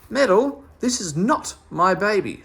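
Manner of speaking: high, loud outraged cry; fierce mother. Acted. Mother confronting Meddle over the wrong baby, fierce cry. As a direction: round on him like a fierce mother cat. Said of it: "like a fierce mother cat".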